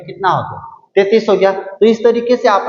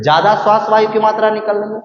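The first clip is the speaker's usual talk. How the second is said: usual speaking voice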